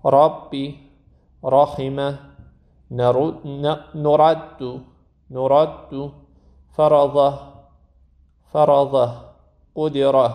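each word with a thin, light ر; ربی and رحمه with the ر kept light. Shown as a demonstration. Quran teacher modeling light R words for students. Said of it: "بریم ترقیق را".

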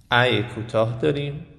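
normal talking voice

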